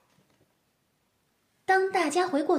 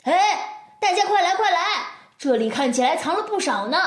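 loud, higher excited call; 4 semitones higher, 4 dB louder, much faster than usual. Acted. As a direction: call everyone over eagerly. Kids call friends excitedly.

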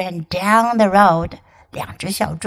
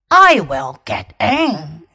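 lower, slow wolf voice; 5 semitones lower, 3 dB louder, slower than usual. Acted. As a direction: play the wolf, low and slow. Wolf insisting.

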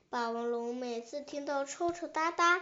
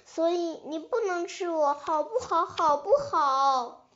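higher voice, growing louder as it begs; pleading baby dinosaur; 4 semitones higher, 4 dB louder than usual. Acted. Baby dinosaur bargains with the tyrannosaur for its life, insistent pleading.

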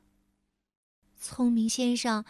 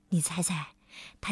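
轻轻耳语着问; 凑近小朋友悄悄地问; 7 semitones lower, 8 dB quieter, faster than usual. A narrator's reading. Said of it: "unvoiced whisper to listener". (讲故事的人对小朋友低声耳语)

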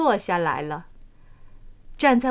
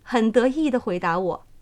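usual speaking voice

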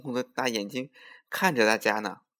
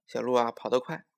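usual speaking voice

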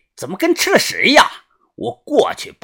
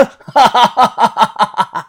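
响亮而癫狂的连串大笑; 高声狂笑不止; 疯狂大笑; 6 semitones higher, 5 dB louder than usual. A narrator's reading. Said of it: "他疯狂的大笑". (癫狂的放声大笑)

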